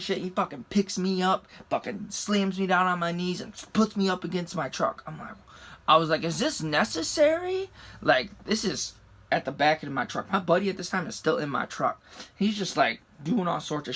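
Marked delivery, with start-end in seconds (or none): 6.2-7.7 high-pitched retold objection; incredulous protest. Handcuffed driver questioning the cop, incredulous protest. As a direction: question it in a much higher pitch.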